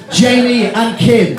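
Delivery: slow, high, loud call; proclaiming the couple's names; toast shout. Real, not acted. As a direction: call the toast to the whole room. Calls toast to the room.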